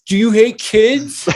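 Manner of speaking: high, loud, slow accusing question; mock accusing question. Fundraiser mocking a donor, slow high accusing. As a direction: act out a pushy fundraiser shaming you. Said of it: "You're like".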